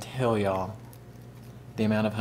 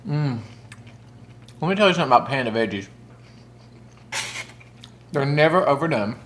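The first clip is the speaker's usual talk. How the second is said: talking while chewing; chewing crunchy food; mouth full of vegetables; 3 semitones higher, faster than usual. Real, not acted. Seen 0: Broccoli bite at -3.0; jaw and lips keep chewing through the speech.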